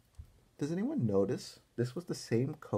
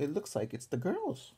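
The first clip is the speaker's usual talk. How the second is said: plain reading voice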